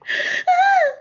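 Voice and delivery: shrill hammed-up gasp of horror; high mock gasp. Liam hearing maximum security, hammed-up high gasp. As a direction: gasp like he just heard doom. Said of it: "And Liam's like".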